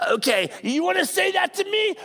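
mock angry retort in a quarrel; picking a fight. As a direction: snap back at someone mid-argument. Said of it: "about to lash out".

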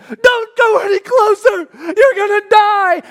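high, loud, terrified shouting, slowed; panicked scream. Retold or imitated. His fear of heights screams at him at the edge, panicked shout. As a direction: scream like the fear inside you is taking over. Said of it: "everything in me starts screaming".

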